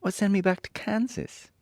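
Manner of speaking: put-on high-pitched girl character voice; Dorothy. A narrator's reading. Dorothy telling friends her wish, high girlish voice. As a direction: lift well up for a little heroine. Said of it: "said Dorothy".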